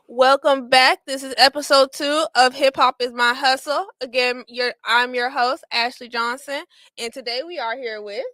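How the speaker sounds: upbeat host opening the show; show host intro. Acted. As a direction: welcome the audience like a host. Host opens the episode.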